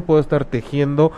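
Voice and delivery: usual speaking voice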